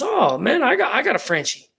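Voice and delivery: high, thrilled cop spotting a cute dog; excited cop. Retold or imitated. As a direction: gush like a cop who just found a cool dog.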